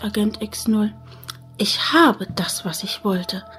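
whispered villain threat; menacing whisper. Acted. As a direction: hiss it like a villain.

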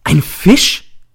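high, loud outburst; startled cry. A narrator's reading. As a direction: cry out in sudden shock. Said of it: "Ich springe auf und schreie". (erschrockener Aufschrei)

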